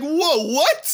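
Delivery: startled, high-pitched double take; shocked reaction. Retold or imitated. Host reliving a movie reveal, mock shock. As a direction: blurt it in high, mock disbelief.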